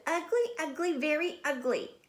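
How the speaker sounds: high mocking schoolyard chant; high sing-song taunt; taunting kids. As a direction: sneer it like teasing classmates. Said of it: "the other kids looked at her and said".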